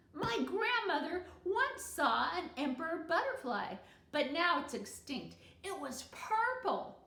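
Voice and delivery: lightly voiced eager schoolboy; boy's voice. A narrator's reading. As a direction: blurt it out eagerly. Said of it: "Barry Tuckerman said".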